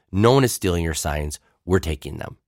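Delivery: usual speaking voice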